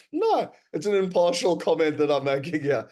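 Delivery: laugh, then mock-innocent denial; sarcastic. To the audience, mocking his own bias about control, with a sarcastic laugh. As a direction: say it with a wink, clearly not impartial.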